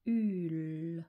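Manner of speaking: "Rul" stretched, held r, long u, lingering l; syllabe décomposée. Shown as a demonstration. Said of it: "J'ai besoin de trois lettres". (« Rul » étiré, u et l prolongés)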